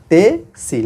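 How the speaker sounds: "তে" and "সি" as two isolated syllables, pause between; syllables separated. Shown as a demonstration. Teacher showing students the verb ending "তে সি", syllables separated. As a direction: spell out the ending slowly: "তে", then "সি". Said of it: "লাস্টে তে সি আছে".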